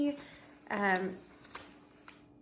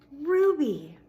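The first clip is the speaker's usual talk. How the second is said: loud, higher, thrilled gasp of the name; joyful recognition; 6 semitones higher, 9 dB louder than usual. Acted. Gorilla spotting his friend on the TV, thrilled. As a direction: burst out with delighted recognition. Ivan sees Ruby on TV.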